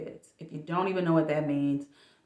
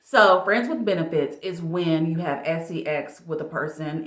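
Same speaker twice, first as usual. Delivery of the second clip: normal talking voice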